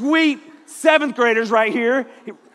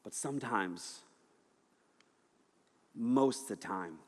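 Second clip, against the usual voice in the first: much softer, slow, intimate preaching; soft reflective sermon; 8 semitones lower, 11 dB quieter than usual. Acted. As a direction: pull the room in close and quiet.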